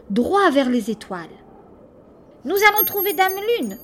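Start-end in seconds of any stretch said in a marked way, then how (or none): 2.4-3.8 shouted. Acted. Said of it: "lui cria le monstre".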